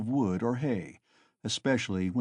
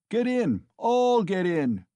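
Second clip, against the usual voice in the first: high, loud, slowing shout; crying out; 9 semitones higher, 5 dB louder, slower than usual. Acted. Mikolka inviting everyone aboard, loud slow shout. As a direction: bellow the invitation. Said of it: "cried Mikolka".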